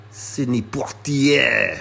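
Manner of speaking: Sidney Poitier in a slow, hammed-up French accent. Sneaker vlogger hamming up a French accent on a name. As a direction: lean into a mock French accent, drawn out. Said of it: "say his name with a French accent".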